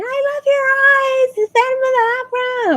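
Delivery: long, very high mock wail; crying fangirl. Teen fan gushing at the actor, mock crying. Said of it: "girl's still crying".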